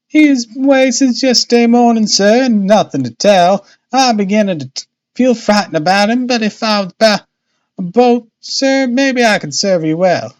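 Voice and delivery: high, dialect woman's voice; worried boatman's wife. A narrator's reading. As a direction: fret aloud, pitched high. Narrator voices worried Missus Smith.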